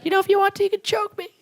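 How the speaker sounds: put-on very high little-kid voice; four-year-old girl. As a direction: imitate a small child's squeaky request. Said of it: "She acted like a four-year-old".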